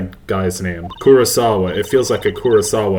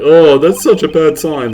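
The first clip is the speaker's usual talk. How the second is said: mocking; 7 semitones higher, 8 dB louder than usual. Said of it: "are like".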